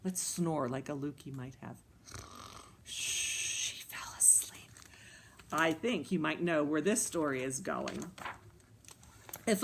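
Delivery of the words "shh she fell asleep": very soft whisper; hushed whisper. A narrator's reading. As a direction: whisper so the sleeper won't wake. Hushing as the girl sleeps.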